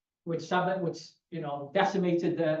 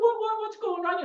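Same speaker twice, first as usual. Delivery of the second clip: incredulous question; 13 semitones higher than usual. Retold or imitated. Asking dad why he came back.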